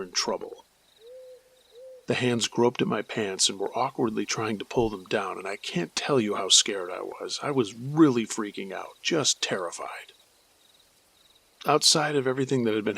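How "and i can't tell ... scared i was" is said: plain reading voice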